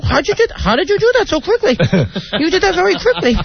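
very high, singsong caricature; Barbara Walters. Retold or imitated. Barbara marvelling at how quickly it was done, high impersonation. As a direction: imitate her astonished praise in a high voice. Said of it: "Yeah, she was like".

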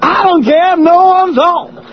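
high-pitched stand-up holler; Chris Rock. Acted. Host riffing on the bedroom bit, Chris Rock impression. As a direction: deliver it as a shouting comedian. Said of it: "That's Chris Rock".

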